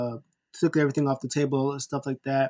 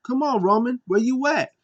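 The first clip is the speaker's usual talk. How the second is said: higher calling-out voice; heel taunt; 10 semitones higher than usual. Retold or imitated. Wrestler calling out his rival, higher taunt. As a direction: call him out from the ring.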